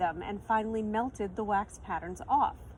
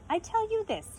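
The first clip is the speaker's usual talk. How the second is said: pitched up, musing start; thoughtful; 12 semitones higher than usual. A narrator's reading. Shopkeeper pausing to think aloud, thoughtful. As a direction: ponder before speaking. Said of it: "he said thoughtfully".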